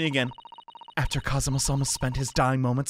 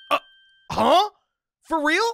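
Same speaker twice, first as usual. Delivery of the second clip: loud, much higher double take; startled; 6 dB louder than usual. Real, not acted. Player hearing the bodyguard reveal, startled surprise. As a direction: jolt up in disbelief.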